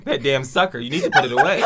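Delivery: laughing while talking; words squeezed out through laughter; laughing hard. Real, not acted. Laughing fit.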